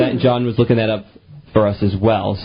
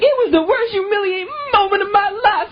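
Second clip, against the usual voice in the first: high, wailing fake-sob friend's voice; mock crying friend. Radio host mocks Oprah's friend, high fake crying. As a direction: wail it like a friend melodramatically crying. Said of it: "one of her friends was like".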